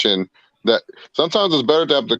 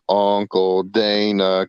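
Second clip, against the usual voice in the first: drawn-out call; 4 semitones lower, much slower than usual. Pretend. Mock calling out to Dana.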